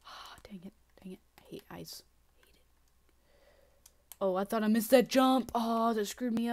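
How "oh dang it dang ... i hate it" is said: whisper. Real, not acted. Quiet grumbling after a fall.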